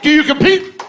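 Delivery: high, loud mocking jab at the rival; loud taunt. Taunting the guy at the bar.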